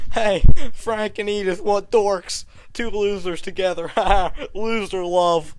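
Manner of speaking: loud jeering taunt; mocking bullies. Classmates mocking Frank and Edith, loud jeering. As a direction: jeer at the dorks loudly. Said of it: "They're all like".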